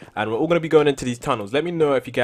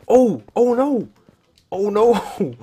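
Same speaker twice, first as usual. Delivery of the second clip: panicked; 8 semitones higher, 8 dB louder, much slower than usual. Real, not acted. Panic as monster runs out.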